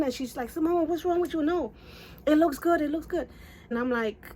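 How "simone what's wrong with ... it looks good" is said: high-pitched imitation of an exasperated sister; high, insistent retold reassurance; protesting sister.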